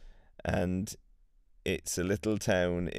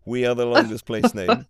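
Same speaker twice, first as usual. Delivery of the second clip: proud boast; 4 semitones higher, 10 dB louder, faster than usual. Retold or imitated. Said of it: "so that they could say".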